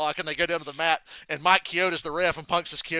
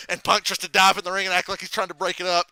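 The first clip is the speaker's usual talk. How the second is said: ordinary talk, nothing marked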